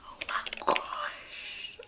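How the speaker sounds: high, slow, stunned whisper; overwhelmed whisper. Real, not acted. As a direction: let the gasp of "oh my gosh" drift out in a whisper. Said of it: "I'm actually, like, shaking".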